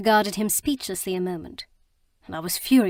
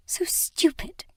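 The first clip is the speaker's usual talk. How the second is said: a slow, breathy whisper through tears; tearful whisper; 9 semitones higher, slower than usual. A narrator's reading. Mina, crying, scolds herself in a slow whisper. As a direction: whisper it slow, barely holding back tears. Tear rolling, self-reproach.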